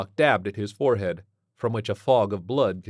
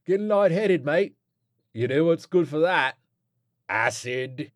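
lightly voiced chummy rogue, pitched higher; cheeky druggie mate; 7 semitones higher, 4 dB louder than usual. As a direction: offer it like a helpful mate.

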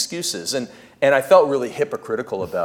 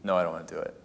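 quiet refusal; 7 semitones lower, 10 dB quieter, much faster than usual. Retold or imitated. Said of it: "And I said".

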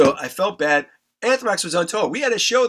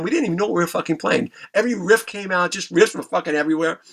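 ordinary delivery

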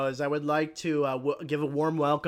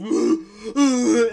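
hammed-up gasp; 7 semitones higher, 13 dB louder than usual. Character sees the walrus.

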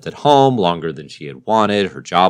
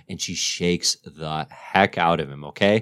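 ordinary talk, nothing marked

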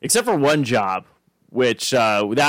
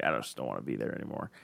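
low mumble; 12 semitones lower, 14 dB quieter, faster than usual. Retold or imitated. Retelling why they quit the job.

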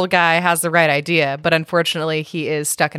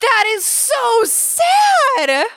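high slow wailing laugh-cry; mock sobbing; much slower than usual. Real, not acted. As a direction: wail it out half laughing. Reacting to the story's ending.

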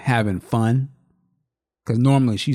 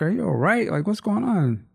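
higher-pitched concerned questions; retold worried checking; 9 semitones higher than usual. Father to his crying daughter, retold concern. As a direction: ask it with mild acted worry, voice raised in pitch.

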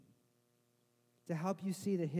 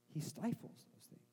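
hushed; 5 dB quieter than usual. Acted. Preacher drops voice on enemy.

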